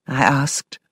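ordinary delivery, nothing marked

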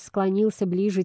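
ordinary delivery

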